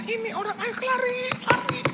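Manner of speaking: very high, urgent mock cries; imitating engrossed wife. Preacher mimics his wife shouting advice at sinetron, high-pitched urging. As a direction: urge them on in a squeaky wife voice. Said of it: "Oh, sinetron".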